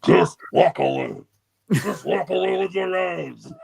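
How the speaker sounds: quoting the movie's villain, mock booming; movie villain quote. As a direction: deliver it like the film's menacing warlord. Quoting Road Warrior line.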